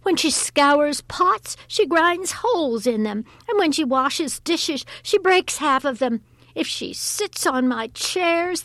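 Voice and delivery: high, loud tirade of complaints; exasperated mistress pleading her case; exasperated cry. A narrator's reading. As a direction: rant like a fed-up mistress. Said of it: "cried the mistress".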